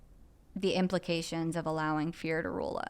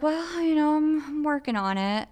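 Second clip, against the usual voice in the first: higher, louder, squirming mock dodge; evasive excuse; 8 semitones higher, 4 dB louder than usual. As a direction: play the cornered sponsee stalling. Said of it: "And I was like".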